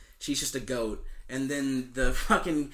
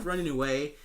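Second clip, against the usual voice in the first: normal talking voice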